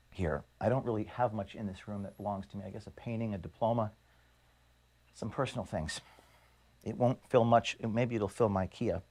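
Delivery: quiet and choked up while listing his few belongings; real emotion, voice sinking low and soft; getting emotional. Real, not acted. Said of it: "I'm sorry, I'm getting emotional".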